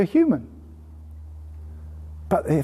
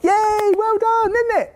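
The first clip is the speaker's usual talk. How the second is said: put-on high, delighted cheer for a baby; excited praise; 12 semitones higher, slower than usual. Shown as a demonstration. Parent cheering a toddler's babble, high and delighted. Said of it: "but we reward them".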